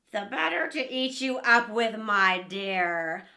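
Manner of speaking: loud, low growling wolf; wolf about to pounce on the girl; menacing wolf. Acted. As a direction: drop the disguise and loom. Wolf reveals himself.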